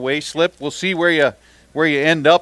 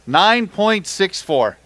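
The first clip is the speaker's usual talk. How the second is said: "Nine point six four" slow and higher, stretched out; announcer call; 6 semitones higher, much slower than usual. Acted. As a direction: boom the weight out to the crowd. Announcing the weight to crowd.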